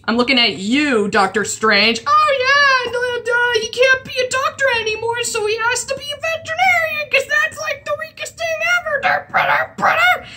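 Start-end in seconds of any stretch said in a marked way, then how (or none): 2.1-10.3 high-pitched dopey mock voice; high, dumb caricature trailing into nonsense sounds; mocking dopey writer. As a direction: mimic clueless writers in a silly high voice. Mocking the writers of another series.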